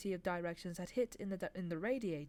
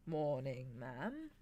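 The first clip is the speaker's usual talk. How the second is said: sing-song; 4 semitones lower, much slower than usual. Acted. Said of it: "he said in a sing-song voice".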